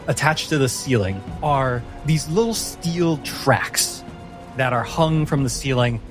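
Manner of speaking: ordinary talk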